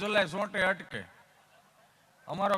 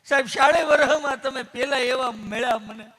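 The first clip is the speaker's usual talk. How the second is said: mock weeping; 4 semitones higher, 7 dB louder than usual. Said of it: "માણસ ગળગળો થઈ ગયો, રોવા મળ્યો".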